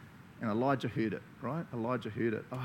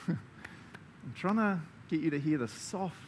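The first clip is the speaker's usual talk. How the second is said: high, then softening and slowing to gentle; God's gentle voice; 4 semitones higher than usual. Pretend. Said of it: "and God's like".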